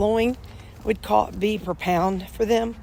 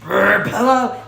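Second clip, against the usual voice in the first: mock burp word; 3 semitones higher, 7 dB louder, slower than usual. Real, not acted. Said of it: "I just burped".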